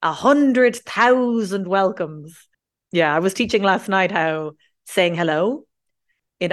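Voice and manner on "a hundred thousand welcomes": slow, stretched-out effusive welcome; hammed-up, lavish greeting; grand overstatement. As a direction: draw it out, above and beyond. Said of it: "we love going above and beyond".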